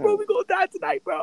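extremely high squeaky panic; mock terrified wail. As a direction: wail it in a tiny squeal, hamming up the fear. Acts out terror of dying tonight.

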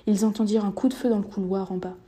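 ordinary delivery

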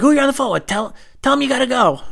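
high, loud mom voice; nosy mom. Retold or imitated. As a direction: bark like a mom cutting in. Mom insisting.

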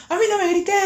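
very high, screechy teacher imitation; outraged teacher. Teacher tells student not to yell, high outraged screech. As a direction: shriek back as the offended teacher. Teacher yelling back, acted.

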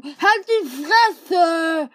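long furious scream; angry shout. Acted. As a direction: stretch the insult out at full volume. Running gag shout.

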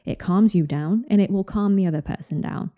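ordinary talk, nothing marked

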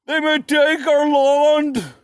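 slow, high, shouted rallying cry; mock battle cry. Wallace shouting to his army, hammed-up battle cry. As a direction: roar it like a hero rallying troops. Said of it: "did not shout".

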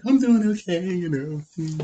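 high, softening mock-okay voice; therapy-patient act. Host mimicking friend at the therapist's, falsely okay voice. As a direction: imitate a guarded patient, high then trailing softly. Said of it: "like you're at your therapist's office".

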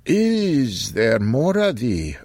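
put-on slow, high old-fashioned voice; a lightly voiced archaic old man; old man Occam.

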